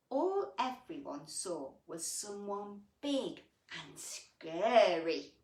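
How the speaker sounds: storybook voice for little ones; gentle picture-book reading; reading to children. A narrator's reading. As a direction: read it like bedtime story time. Reads picture book aloud.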